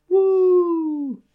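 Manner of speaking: high whooping cheer. Real, not acted. Host cheers the Sword and Buckler list, excited. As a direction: whoop for the winners.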